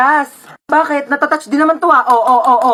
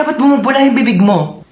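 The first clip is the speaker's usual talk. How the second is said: usual speaking voice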